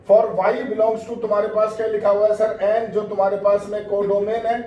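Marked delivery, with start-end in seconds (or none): none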